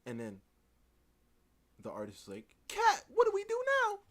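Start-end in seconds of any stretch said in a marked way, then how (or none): 2.7-4.0 loud, very high-pitched wail; mock panic. Artist turning to Kat for rescue, loud high mock panic. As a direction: cry for help, hammed-up.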